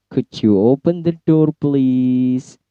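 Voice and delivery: polite request with a long, held "please"; request intonation. Shown as a demonstration. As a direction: ask courteously, stretching "please". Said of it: "expressing request biasanya menggunakan low intonation". (permintaan sopan, "please" dipanjangkan)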